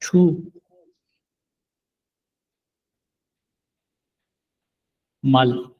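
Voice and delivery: छु then मल, split with a long pause; syllable by syllable. Shown as a demonstration. Korean teacher splitting छुमल into syllables. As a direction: break छुमल into छु and मल. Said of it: "छु बोलते हैं".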